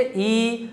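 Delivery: "ई" as a long, steady ee; demonstrated vowel. Shown as a demonstration. Teacher showing students the tongue position, demonstrated vowel. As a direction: hold "ई" softly on a level pitch. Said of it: "आप बोल के देखिए".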